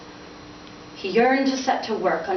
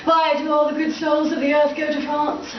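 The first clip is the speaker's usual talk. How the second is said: pitched-up mocking father; father's voice; 8 semitones higher than usual. Father teasing his son about France. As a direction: needle the son with a jab.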